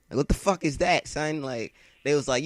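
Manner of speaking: ordinary delivery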